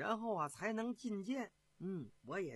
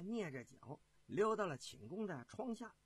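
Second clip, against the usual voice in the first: ordinary delivery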